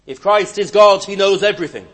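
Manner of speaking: loud, high emphatic point; fervent pulpit declaration; preaching surge. Acted. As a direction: drive the point home loudly.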